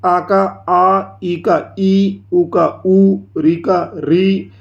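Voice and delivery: slow "आ का आ, ई का ई", second vowel stretched long; teacher's deliberate long-vowel demonstration; lengthened long vowels. Shown as a demonstration. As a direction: draw out each long vowel for two beats. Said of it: "उनको बड़ा कर देते हैं".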